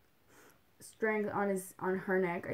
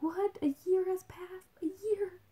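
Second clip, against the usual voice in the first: amazed disbelief; 10 semitones higher than usual. Retold or imitated. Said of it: "so it's kinda like".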